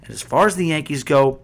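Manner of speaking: ordinary talk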